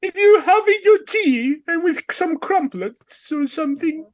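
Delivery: high, hammed-up British tea-time voice; mock British accent. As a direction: put on an exaggerated British accent. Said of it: "do a fucking British ass accent".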